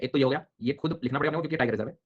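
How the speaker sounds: ordinary talk, nothing marked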